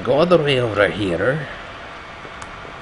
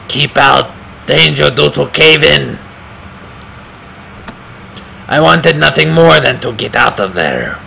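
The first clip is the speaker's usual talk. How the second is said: put-on louder accented narration; narrator in a put-on accent; 5 semitones higher, 5 dB louder than usual. Player voicing the game's hero, louder put-on accent. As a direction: read the game text in the hero's accent, louder. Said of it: "that's the accent I want him to speak in".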